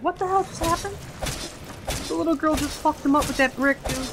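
high, loud disbelieving yell; shocked outburst. Real, not acted. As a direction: blurt it out in total disbelief. Shocked reaction while gaming.

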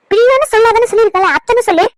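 put-on high-pitched telling-off of a child; indignant scolding. Aunt tells boy not to call her by name, indignant scolding. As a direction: scold the little one, voice jumping up.

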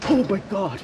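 high, loud yelp of shock; startled shout. Real, not acted. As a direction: jump and shout in fright. Sudden scare.

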